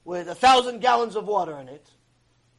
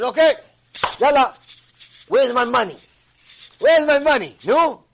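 loud, high, mocking demand for money; demanding shout; 4 semitones higher, 10 dB louder than usual. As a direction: hammer him for the cash.

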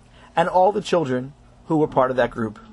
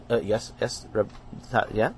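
soft, halting flustered voice; flustered stammer; 8 semitones lower, 7 dB quieter than usual. Acted. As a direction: stumble over the words.

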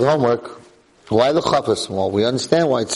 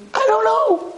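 very much higher comic squeak; squeaky shrug answer. Retold or imitated. Rebbe admitting to the student he has no answer, comic squeak. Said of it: "And I'm gonna answer you".

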